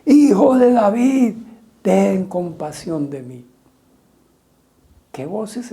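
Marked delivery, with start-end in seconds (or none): 0.0-3.5 high, loud and slow pleading cry; louder shout. Retold or imitated. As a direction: shout it louder than the crowd. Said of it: "se puso a gritar aún más".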